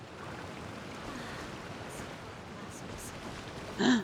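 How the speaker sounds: sharp gasp. Real, not acted. Recognizes a familiar character.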